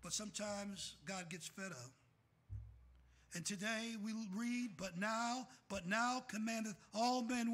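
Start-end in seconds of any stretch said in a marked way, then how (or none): 3.3-7.5 building scripture read, louder by the end; preaching. Acted. Preacher reading scripture to his church, rising force. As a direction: declaim it to the pews.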